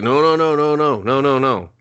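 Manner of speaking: high, loud rapid no's; hammed-up urgent stopping; urgent mock no's. Chip stopping the hero's outburst, loud and high. Calming him down, repeated no.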